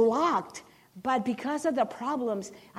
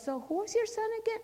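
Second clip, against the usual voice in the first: principal, higher and fast, puzzled; quick curious principal; 10 semitones higher, 4 dB quieter, faster than usual. Retold or imitated. Principal asking the mother again, higher and fast. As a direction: rattle it off like a distracted principal.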